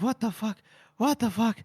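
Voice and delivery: soft, stunned retold mutter; quietly voiced bewilderment; dazed disbelief. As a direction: say it under your breath, reeling. Head spinning after unexpected kiss.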